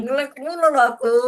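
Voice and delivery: high loud grumbling; loud whiny complaint. Real, not acted. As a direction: complain loudly about the lag. Kid complaining about lag.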